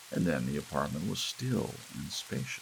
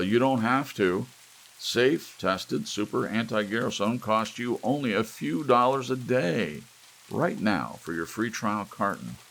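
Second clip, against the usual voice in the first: loud, higher, hard-selling pitchman; commercial read; 6 semitones higher, 6 dB louder than usual. Acted. TV announcer selling Anti-Garrison to old viewers, loud hard sell. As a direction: sell it like a fifties TV ad.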